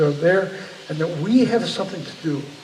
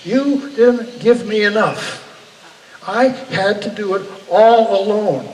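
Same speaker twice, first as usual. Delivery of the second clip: higher, louder, slower furious cry at God; retold scream at God; 5 semitones higher, 7 dB louder than usual. Retelling a dream of screaming in God's face, angry and louder. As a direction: hurl the accusation upward in retold rage. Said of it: "I just screamed in his face".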